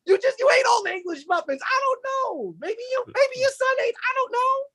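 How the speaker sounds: very high, exasperated on a muted call; frazzled high voice. Retold or imitated. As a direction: squeak it out, flustered at home. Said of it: "I'm like".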